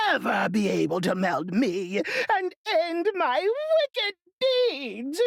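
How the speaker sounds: high, slow dying witch voice ending in a cry; melting witch. A narrator's reading. Wicked witch melting away before Dorothy, high and wailing.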